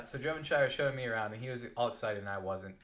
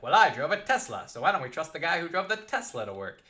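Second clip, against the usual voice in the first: louder, higher, smug boast; smug boss bragging about his Tesla to an employee, louder; arrogant boss; 6 semitones higher, 8 dB louder than usual. Retold or imitated. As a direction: lord it over him like a rich jerk boss. Arrogant boss lording his car.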